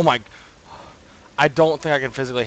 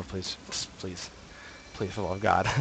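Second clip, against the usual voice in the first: whispered plea; 4 semitones lower, 8 dB quieter than usual. Real, not acted. Begging to stop playing.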